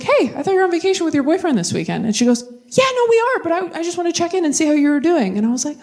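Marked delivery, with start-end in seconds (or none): 0.0-2.1 surprised. Retold or imitated. Comic on phone to Courtney. 2.7-5.4 high, bright and caring; sweetly checking in; sweet concern. Retold or imitated. Best friend Courtney calling to check on the comic, sweet and high.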